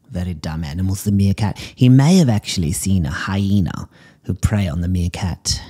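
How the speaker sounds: ordinary talk, nothing marked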